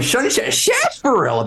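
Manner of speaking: high, put-on old prospector; old-timey prospector voice. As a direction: say it like a grizzled gold miner. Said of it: "I was rehearsing saying it like that".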